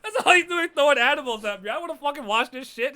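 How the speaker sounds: loud, high, laughing rant; laughing loud complaint. Real, not acted. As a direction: yell it through laughter, fed up. Laughing, fed up with fight.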